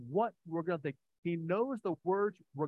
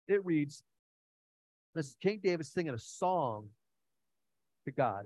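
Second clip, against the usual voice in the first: ordinary talk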